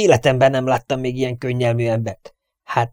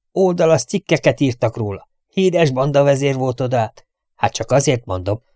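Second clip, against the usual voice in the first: usual speaking voice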